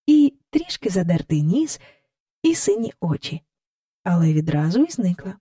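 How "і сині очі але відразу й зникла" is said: ordinary delivery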